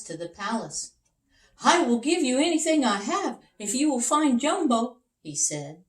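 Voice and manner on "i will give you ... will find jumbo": higher, louder, fast eager king; a king asking anxiously; pleading king. Acted. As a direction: beg like a worried king. King voiced in story.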